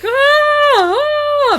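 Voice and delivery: high, loud anguished screams; screaming in pain. Retold or imitated. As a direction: scream out in agony. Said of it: "this poor, like, S O B is like".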